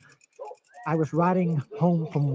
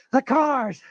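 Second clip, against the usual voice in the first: much higher and louder panic; fearful outburst; 8 semitones higher, 5 dB louder than usual. Acted. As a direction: blurt it out in terror. Repeated line escalating.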